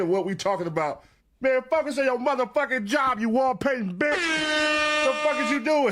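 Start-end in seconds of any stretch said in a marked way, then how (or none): 1.4-5.9 mocking jab. Real, not acted. Podcaster taunts the wall painter, higher and dragged out.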